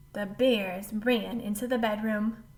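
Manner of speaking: usual speaking voice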